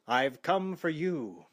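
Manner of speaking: slow, grave threat; horror movie hero. A narrator's reading. Vampire hunter confronting the vampire woman, slow and grave. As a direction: announce doom to the vampire. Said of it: "the hero said".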